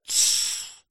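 soft, voiceless hissed hush; whispered shush. A narrator's reading. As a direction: hush him with a long, soft "sss". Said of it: "зашептал еврей".

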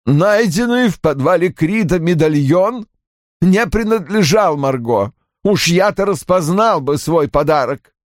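high, strained father's protest; forced out. A narrator's reading. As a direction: squeeze it out, holding back upset. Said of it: "выдавил Фипс и стиснул дрожащие губы".

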